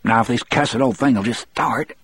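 high, fretful muttering. A narrator's reading. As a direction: grumble to yourself in a thin high voice. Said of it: "still muttering".

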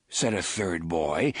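ordinary delivery

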